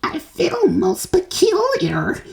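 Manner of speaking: put-on very high, slow, gasping girl; girl short of breath as she swells. A narrator's reading. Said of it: "gasped Violet".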